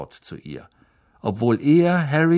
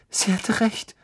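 higher, tearful first realisation; 11 semitones higher than usual. Acted. Lavender realising the prophecy came true, tearful. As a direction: say it with a catch in the throat.

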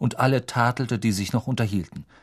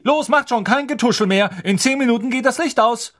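much higher and louder prefect orders; bossy shout; 12 semitones higher, 6 dB louder than usual. Acted. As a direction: hurry everyone along with authority. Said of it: "rief Percy".

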